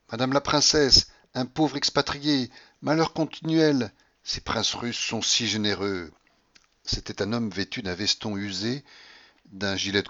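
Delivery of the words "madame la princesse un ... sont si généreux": slow, wheedling plea; pleading beggar. Acted. Poor expatriate begs the rich old lady, pleading. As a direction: beg slowly for charity.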